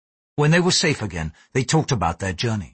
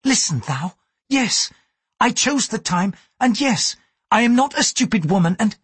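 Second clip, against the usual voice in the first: much higher and louder, building indignation; louder, rising anger; 11 semitones higher than usual. A narrator's reading. Mariko snaps back at Blackthorn in rising anger, high and loud. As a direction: flare up at him, pitch climbing. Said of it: "Her voice became louder".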